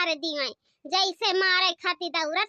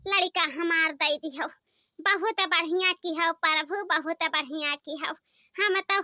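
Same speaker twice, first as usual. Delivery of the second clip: lower, earnest prayer to the Lord; 4 semitones lower than usual. Acted. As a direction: pray thankfully, voice pitched down.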